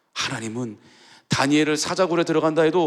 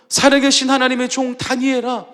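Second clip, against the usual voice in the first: high, loud call full of certainty; king crying out in faith at the den; confident cry of faith; 6 semitones higher, 4 dB louder than usual. Retold or imitated. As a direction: call out expecting an answer. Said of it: "믿음에 찬 확신이에요".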